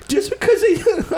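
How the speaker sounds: high, slow, lightly attempted Canadian accent; high-pitched Canadian accent breaking into laughter. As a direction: laugh through a rough Canadian imitation. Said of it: "I can't do the accent".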